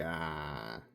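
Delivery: ordinary talk, nothing marked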